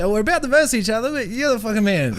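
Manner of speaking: high, delighted praise of a rival; amused admiration. Retold or imitated. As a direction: gush at the rival playfully.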